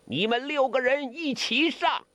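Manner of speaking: high, contemptuous dare; cold sneer. A narrator's reading. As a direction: sneer the challenge out, pitched high. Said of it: "梅超风冷笑". (冷笑着挑衅)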